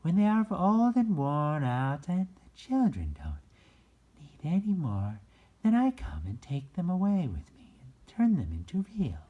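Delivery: high, loud, bright fairy voice; high sweet fairy speaking to the rabbit. A narrator's reading. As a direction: lift it way up into a fairy's voice. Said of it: "she said".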